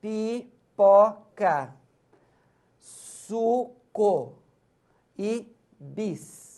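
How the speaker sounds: Pipoca, suco e bis read out slowly, syllable by syllable. Shown as a demonstration. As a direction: sound out each word for the class. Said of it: "olha só".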